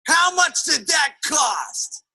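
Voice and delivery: hammed-up accusing question, every word stretched; indignant husband. Negative husband asks wife the price, indignant.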